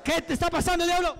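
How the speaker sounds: high, forceful demand; challenging the devil. Real, not acted. Preacher to the devil inside a woman, challenging. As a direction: confront the demon head-on.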